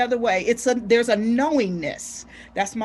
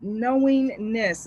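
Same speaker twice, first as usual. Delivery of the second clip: slow deliberate; much slower than usual. Shown as a demonstration. Slow deliberate word pronounced.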